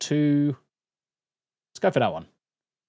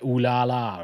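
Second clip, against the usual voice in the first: mock French. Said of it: "it's gonna say".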